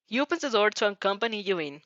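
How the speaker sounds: plain reading voice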